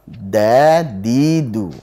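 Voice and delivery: very slow Dadidu with the tongue on the base of the upper teeth; correct dal. Shown as a demonstration. Teacher giving the right dal as the answer. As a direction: stretch out Dadidu from one spot. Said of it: "Jadi harusnya apa".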